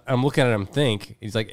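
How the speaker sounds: usual speaking voice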